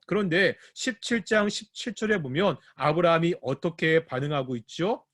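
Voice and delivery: normal talking voice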